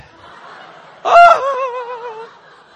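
high, loud drawn-out "oooh"; mock wail. Preacher parodying a sad story for the audience, mock wail. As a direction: wail theatrically on one long note.

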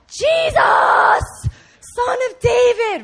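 high, full-voiced desperate shout; screaming. Blind beggar screaming for Jesus over the crowd, desperate shout. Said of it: "He's just screaming".

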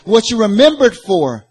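high, loud sermon delivery; forceful preaching. Real, not acted. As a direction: drive each point home to the congregation.

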